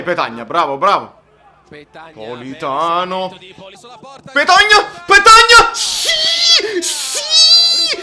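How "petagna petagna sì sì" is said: very high, loud goal yell; ecstatic scream. Real, not acted. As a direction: scream the name and celebrate. Goal celebration.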